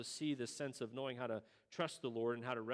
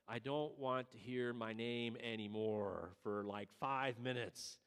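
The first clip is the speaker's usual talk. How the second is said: slow, drawn-out, lower plea; exhausted mom; 5 semitones lower, slower than usual. Retold or imitated.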